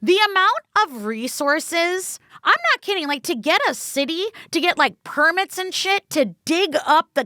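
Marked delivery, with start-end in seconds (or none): none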